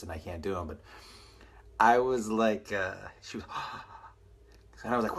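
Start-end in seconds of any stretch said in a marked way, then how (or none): 3.5-4.1 imitated gasp of surprise; surprised gasp. Comic mimicking his older date in bed, mock surprised gasp. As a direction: suck in air as if startled. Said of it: "say that she looked surprised".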